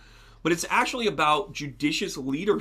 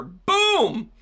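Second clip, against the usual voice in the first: a sudden boom, much higher and louder; excited boom; 5 dB louder than usual. Real, not acted. Card pulled for Rainbow, a real excited boom. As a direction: slam the reveal home with a burst. Card flips, big reveal.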